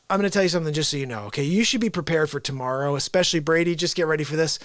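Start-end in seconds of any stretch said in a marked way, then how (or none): none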